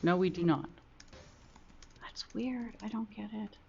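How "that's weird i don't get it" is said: muttered under the breath; whispering. Real, not acted. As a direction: whisper it to yourself, puzzled. Confused over missing captions.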